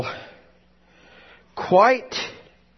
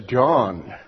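voice of God; 9 semitones lower, 5 dB quieter, slower than usual. Acted. God speaks from the sprinkler.

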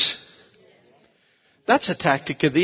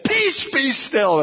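slow, high, drawn-out command to the storm; commanding the wind; 9 semitones higher, slower than usual. Retold or imitated. Pastor rebuking the wind outside his house, slow high command. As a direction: order the wind to be still. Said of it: "I meant it with every".

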